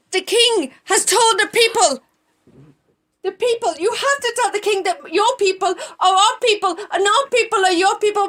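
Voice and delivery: high, loud urgent warning; urgent Mordecai. Acted. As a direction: rush in with dreadful news to the queen.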